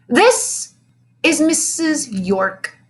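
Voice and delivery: high then slow, showman's unveiling; grand exclaim. A narrator's reading. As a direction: present her with a flourish. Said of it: "Mister Jupiter exclaimed".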